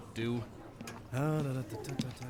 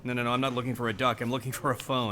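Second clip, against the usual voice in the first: hurried correction; faster than usual. Acted. Correcting absurd misunderstanding.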